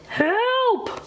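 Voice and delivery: a blinded toad squeals for help; cried for help. A narrator's reading. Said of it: "cried Toad".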